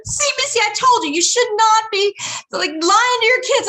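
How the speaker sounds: high, loud told-you-so; grandma chiding her daughter about lying; scolding grandmother. Retold or imitated. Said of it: "I hear my mother".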